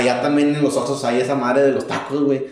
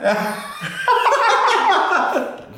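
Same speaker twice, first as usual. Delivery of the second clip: high laugh. Real, not acted. Laughing at own remark.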